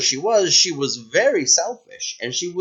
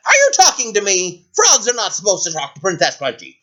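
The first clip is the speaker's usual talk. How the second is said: haughty scolding, pitched up; spoiled princess, indignant; 5 semitones higher than usual. Acted. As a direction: put an upstart creature in its place.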